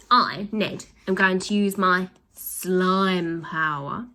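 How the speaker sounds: slow, grand announcement; announced. Acted. Boy announces his slime power to villains, slow and grand. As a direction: proclaim it slowly. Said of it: "announced Ned".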